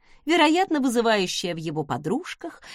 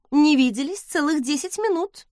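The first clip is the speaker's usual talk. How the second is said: higher-pitched dry scoff; scornful snort; 5 semitones higher, 3 dB louder than usual. A narrator's reading. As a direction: scoff it lightly, pitch lifted. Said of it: "фыркнула я". (насмешливо фыркая)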